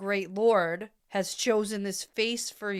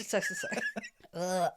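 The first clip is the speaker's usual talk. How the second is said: laughing; 2 semitones lower, 6 dB quieter than usual. Real, not acted. Laughs at friend's quip.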